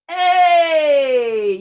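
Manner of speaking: high, loud joyful yell; cheering shout. Shown as a demonstration. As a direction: shout out a happy hey. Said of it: "And a final shout".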